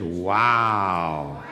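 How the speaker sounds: low, awed wow; low amazed wow. Acted. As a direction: marvel in a low voice. Disciples amazed.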